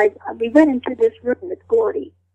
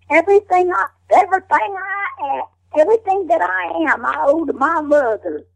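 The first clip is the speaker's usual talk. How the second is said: mountain woman line; 6 semitones higher than usual. Retold or imitated. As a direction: deliver the audition line like Ida Lee. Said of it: "I used that voice".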